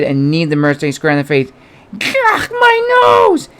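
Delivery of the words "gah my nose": high, slow, crying shout of pain; pained yell. Acted. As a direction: howl as your nose breaks. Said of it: "yelled the wild man".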